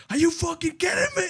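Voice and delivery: high-pitched mock-furious outburst; imitated freak-out. Dad storming into school, mock furious. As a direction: explode like an outraged dad, half laughing. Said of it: "he would freak the fuck out".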